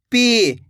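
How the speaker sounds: पी with the ee held long; drawn-out vowel. Shown as a demonstration. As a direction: stretch the ee of पी. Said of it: "खींच खींच के किया जाता है".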